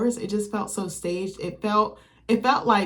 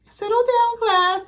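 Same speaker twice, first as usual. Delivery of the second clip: much higher, louder strict teacher voice; mock stern classroom order, pitched way up; Miss Hightower; 6 dB louder, slower than usual. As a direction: bark it like a cartoon teacher calming the class. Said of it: "She'd be like".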